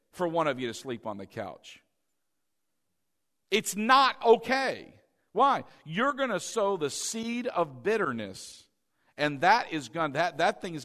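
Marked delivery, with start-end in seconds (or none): 3.5-4.9 It's NOT okay, slow and loud; emphatic warning. Real, not acted. Preacher warning the congregation, loud and slow. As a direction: hammer the word not. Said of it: "Did you hear what I said".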